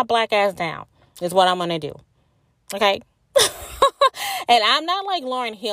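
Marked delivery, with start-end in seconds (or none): 3.3-4.4 real high-pitched squealing laugh; real squeal of laughter. Real, not acted. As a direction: let out a squeaky burst of laughter. Laughs after joke.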